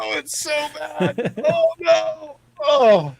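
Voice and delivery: very high, stretched wail; mock despair. Real, not acted. Host mourning his ruined bumper, wailing in dismay.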